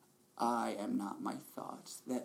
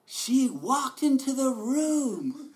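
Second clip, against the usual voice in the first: much higher, louder, slow storybook narration; narrating inner voice; 13 dB louder, much slower than usual. Retold or imitated. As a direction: narrate your own entrance like a dramatic novel. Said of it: "incessant voice in your head narrating".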